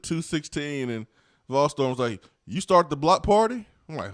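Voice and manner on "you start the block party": high, loud imitation of an eager asker; mock excited question. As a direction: blurt the question out loudly, acting someone else. Acts out a message's question.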